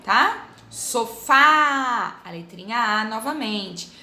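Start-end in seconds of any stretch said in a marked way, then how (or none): 0.7-2.2 Sofá with a long, loud final FÁ; stressed syllable stretched. Shown as a demonstration. Teacher showing the strongest syllable, Sofá ending on a held FÁ. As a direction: lean hard and long on Sofá's last syllable. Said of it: "ver qual que é a mais forte".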